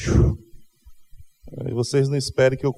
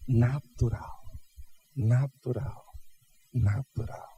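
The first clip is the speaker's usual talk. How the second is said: "natural" said three times, each one softer; soft repeated emphasis; 7 dB quieter, much slower than usual. Shown as a demonstration. Pastor driving home his point, fading softer repeats. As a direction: let each "natural" fade quieter. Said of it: "A providência vem de maneira".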